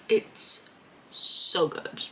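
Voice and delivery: breathy, hushed "so good"; whisper. Real, not acted. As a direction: whisper it like a secret delight. Gushing over favourite scene.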